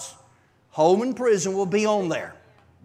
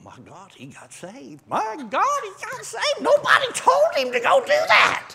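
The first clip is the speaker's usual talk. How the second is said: rising from hushed disbelief to a high shout; amazed exclamation; 6 dB louder than usual. Retold or imitated. Preacher recounting a convert's change, building to a shout. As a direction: let the wonder build until it bursts out.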